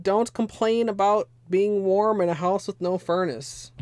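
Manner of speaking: usual speaking voice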